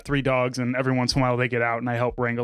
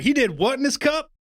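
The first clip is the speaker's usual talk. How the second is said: Mater impression; 10 semitones higher than usual. Retelling a Cars joke to his co-host, light Mater voice. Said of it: "Larry the Cable Guy's Mater is just like".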